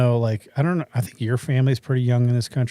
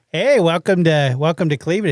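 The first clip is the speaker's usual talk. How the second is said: mock Cleveland accent, slow and drawn out; lingering, hammed-up Cleveland greeting; 3 semitones higher, 4 dB louder than usual. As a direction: stretch out a folksy Cleveland welcome. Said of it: "Or they're gonna be like".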